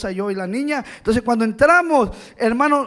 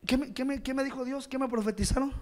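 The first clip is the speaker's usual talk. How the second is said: softer, doubtful muttering questions; mock the confused believer quietly; mock unsure mumble; 11 dB quieter than usual. Mocking those unsure of prophecy. (murmullo burlón e inseguro)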